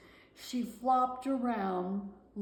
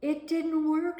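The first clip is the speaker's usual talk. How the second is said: lightly voiced disappointed bird; 10 semitones higher, 7 dB louder than usual.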